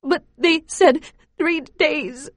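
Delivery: put-on high, slow, tearful child protest; cling to the promise through tears; child sobs her protest to father; sobbing. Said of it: "His words make me sob harder".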